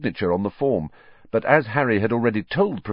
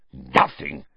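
a lower, rising, scornful echo; spat in disbelief; 6 semitones lower than usual. A narrator's reading. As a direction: throw the word back with contempt. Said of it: "spat Filch".

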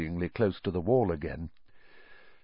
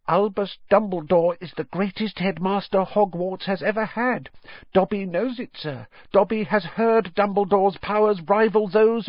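high, squeaky devoted elf; a high elf voice pleading earnestly; house-elf Dobby; 11 semitones higher than usual. Acted.

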